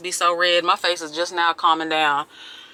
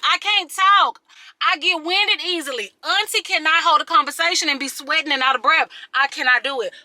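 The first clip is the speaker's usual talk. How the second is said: raised pitch, emphatic pleading complaint; exasperated rant; 6 semitones higher than usual. Acted. Telling gym admirers not to interrupt cardio, exasperated. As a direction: scold an intruder, comically fed up.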